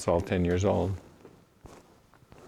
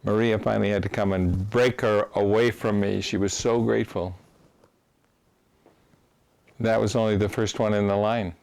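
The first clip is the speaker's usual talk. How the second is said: usual speaking voice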